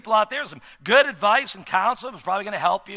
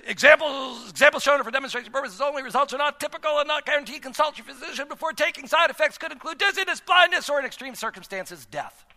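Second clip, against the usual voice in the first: rattled-off radio disclaimer, very fast; fast legal disclaimer; 6 semitones higher, faster than usual. Retold or imitated. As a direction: race through the fine print like an ad. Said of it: "fast-talking disclaimers at the end".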